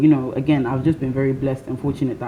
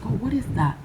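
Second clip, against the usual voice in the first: put-on quoting old man; 8 semitones higher than usual. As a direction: mimic a puzzled old stranger. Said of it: "He's like".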